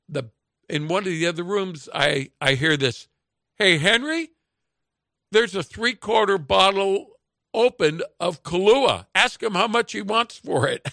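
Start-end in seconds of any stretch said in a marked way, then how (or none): 3.6-10.9 raised, higher voice hailing a partner; calling out. Retold or imitated. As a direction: imitate calling to someone in another room. Woman or man calls from another room.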